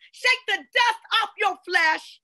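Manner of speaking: high, loud, slow shouted proclamation; preacher shouting scripture to the congregation; fiery preaching. Real, not acted. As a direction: command them to rise, full force.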